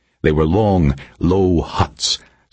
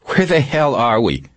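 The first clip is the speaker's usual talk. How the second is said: raised-pitch captive's demand; demanding question; 8 semitones higher than usual. A narrator's reading. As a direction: demand an answer from your captor. Captive demands to know location.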